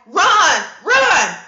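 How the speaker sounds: loud high yell of run; shouted warning. Retold or imitated. Calling out to strangers to flee the dust storm, loud and urgent. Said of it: "I'll be like".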